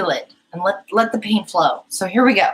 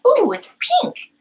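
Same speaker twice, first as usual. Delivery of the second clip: very high, cooing mock delight; imitated delight. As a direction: squeal lightly as if re-enacting your first wonder. Recalls delight at a pink planet.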